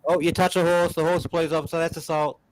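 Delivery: high mocking police voice, fading out; smug petty cop, trailing off. Mocking police calling horse-touching assault, high and smug.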